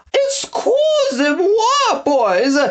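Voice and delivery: very high, slow, fluttery French lady with a laugh; Madame Frou-Frou voice. A narrator's reading. Snatcher calls to the crowd as a high French lady. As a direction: trill it like a vain grande dame. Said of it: "cried the voice of Madame Frou-Frou".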